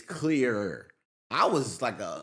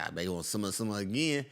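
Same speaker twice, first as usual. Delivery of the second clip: low quiet taunt; 4 semitones lower, 5 dB quieter than usual. Retold or imitated. Quiet taunt about the kid.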